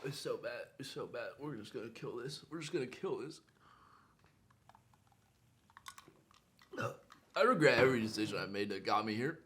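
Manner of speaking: weak, strained with pain. Real, not acted. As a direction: groan the words out in pain. Suffering from the hot pepper.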